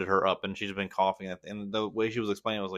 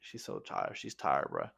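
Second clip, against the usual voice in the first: whispered pity; 9 semitones lower, 7 dB quieter, slower than usual. Real, not acted. The sick dog is exhausted.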